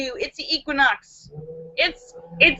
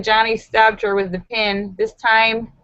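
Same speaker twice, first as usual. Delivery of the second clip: ordinary talk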